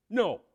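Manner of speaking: a flat, decisive No; emphatic no. Retold or imitated. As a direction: slam the door with No. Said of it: "I said very emphatically".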